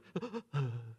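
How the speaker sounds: soft, hitching mock sobs; imitated tearless sobbing. Shown as a demonstration. Pastor showing a kid with no tears left, mock sobbing. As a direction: imitate a kid all cried out, gasping. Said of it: "when there were no more tears and you're just like".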